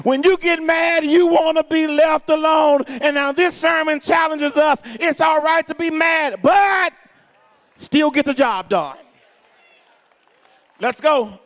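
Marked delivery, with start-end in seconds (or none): 0.0-9.0 higher, insistent preacher's shout; full-force preaching. Acted. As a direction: press it urgently on the congregation.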